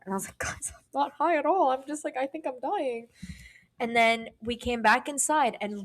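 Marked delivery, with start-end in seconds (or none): 0.4-3.1 high, softened mock plea; whimpery imitation of yourself freaking out; panicked pleading. Retells own panicked plea to friends.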